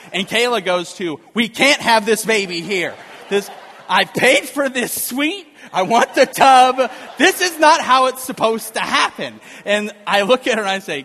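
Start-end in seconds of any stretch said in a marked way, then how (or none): 1.3-9.4 imitating wife's loud, high shouting; outraged protest. Laboring wife objects to giving birth at home, outraged.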